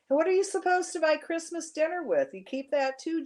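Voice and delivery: lightly voiced gruff old man; kind old man.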